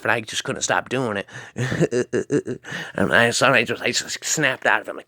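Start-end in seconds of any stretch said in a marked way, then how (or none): 1.3-2.9 staccato, chuckling cartoon laugh; long stuttering "uh-huh-huh" laugh; crazy Butthead laugh. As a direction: imitate Butthead's dopey machine-gun chuckle. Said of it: "with that butthead laugh".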